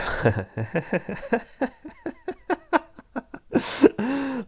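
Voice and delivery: long, high wheezing laugh; giggling fit. Real, not acted. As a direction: crack up helplessly at your own innuendo. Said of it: "Oh, I'm so mature".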